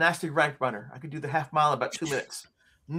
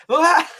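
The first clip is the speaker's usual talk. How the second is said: high, loud mock scream; startled yelp; 11 semitones higher, 7 dB louder than usual. Showing his reaction to seeing the thing, startled yelp. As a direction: yelp as if jumping from a sudden sight.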